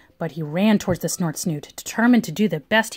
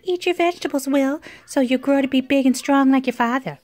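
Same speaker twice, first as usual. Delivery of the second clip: higher-pitched motherly voice; urging mother; 4 semitones higher than usual. A narrator's reading. Mother tells Will to eat his vegetables, urging. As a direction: coax a small child in a raised, put-on mother voice.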